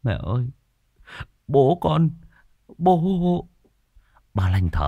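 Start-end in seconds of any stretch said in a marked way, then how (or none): 0.0-3.5 a son near tears unable to finish; choked-up sobbing. Acted. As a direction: voice rising and breaking into a sob. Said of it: "anh Khiêm mếu máo". (anh Khiêm mếu máo nghẹn ngào)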